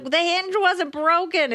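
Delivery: high, slow protest; retold exasperation. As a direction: stretch it out in incredulous complaint. Retold protest about botched repair.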